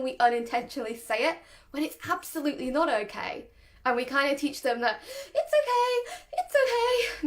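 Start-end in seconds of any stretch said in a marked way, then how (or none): 5.3-7.3 high panicky squeal of reassurance; shrieky-high, anxious sing-out; startling, high-pitched. Shown as a demonstration. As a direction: reassure a dog while clearly panicking. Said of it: "quite startling and quite high pitched".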